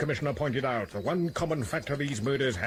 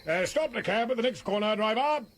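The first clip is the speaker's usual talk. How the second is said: raised voice to the driver; calling out; 10 semitones higher than usual. Acted. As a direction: call up to the cab driver.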